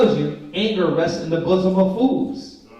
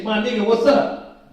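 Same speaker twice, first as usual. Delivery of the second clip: a loose, slangy hey-what's-up, a touch louder; casual street greeting; 3 semitones higher, 4 dB louder than usual. Retold or imitated. Teacher mimics the greeting kids should avoid, casual and upbeat. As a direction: toss it off like greeting a friend on the corner. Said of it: "But you don't just say".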